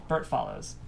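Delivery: ordinary delivery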